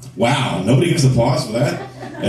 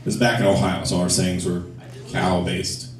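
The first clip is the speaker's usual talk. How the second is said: usual speaking voice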